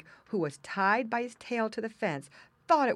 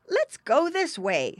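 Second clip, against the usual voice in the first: slow, high, loud piglet voice; older pig boy; 9 semitones higher, 6 dB louder, slower than usual. A narrator's reading. Older pig picks a path, high and slow. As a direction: propose it firmly, high and drawn out. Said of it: "said the older pig boy".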